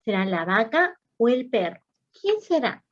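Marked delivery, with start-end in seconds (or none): 2.1-2.8 playful teasing question. Shown as a demonstration.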